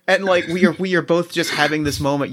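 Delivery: ordinary talk, nothing marked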